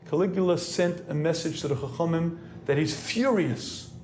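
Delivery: normal talking voice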